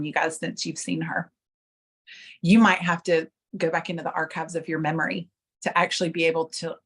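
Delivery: ordinary delivery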